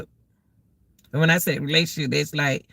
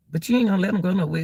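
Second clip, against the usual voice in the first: usual speaking voice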